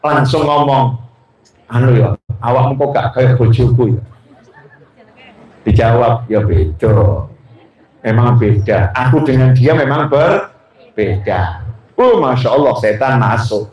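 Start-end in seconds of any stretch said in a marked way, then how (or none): 1.7-4.0 low, chuckling confession; half-laughing flirtation; smitten husband. Acted. Husband flirting with Fulanah, low and laughing. 6.3-11.7 very low, slow, sweet reply; coy friend. Acted. Fulanah sweetly answering the husband, low and slow.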